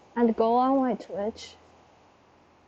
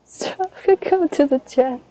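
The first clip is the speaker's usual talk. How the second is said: ordinary talk, nothing marked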